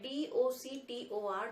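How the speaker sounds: slow, letter by letter spelling. Shown as a demonstration. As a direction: spell each letter out slowly and separately. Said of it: "English में जब लिखते हैं".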